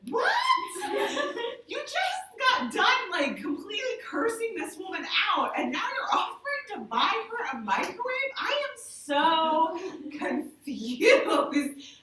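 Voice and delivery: high, loud, baffled outburst; disbelieving. Retold or imitated. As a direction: squeal out the confusion, drawing out the last line. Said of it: "I was like".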